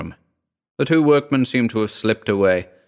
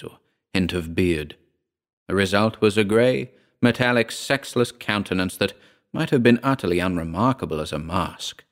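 plain reading voice

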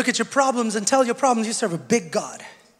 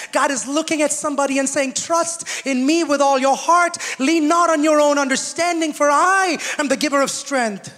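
higher, firm sermon delivery voicing God; preached promise; 7 semitones higher, 5 dB louder than usual. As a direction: proclaim it as God's own words.

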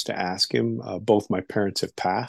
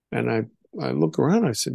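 ordinary talk

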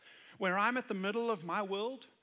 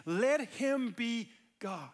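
usual speaking voice

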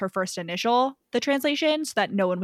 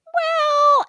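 a squeaky, much higher stalling "Well"; hesitant well; 6 dB louder than usual. Retold or imitated. Hawley stalling when Lucy asks for credit, squeaky high "Well".

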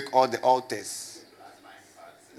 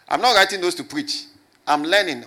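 emphatic insistence; 5 semitones higher, 6 dB louder than usual. Real, not acted. Preacher insisting he is learning.

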